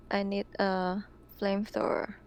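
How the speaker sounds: ordinary talk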